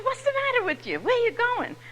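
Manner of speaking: doorman's question retold, pitched high. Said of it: "he said".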